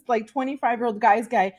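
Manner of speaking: ordinary delivery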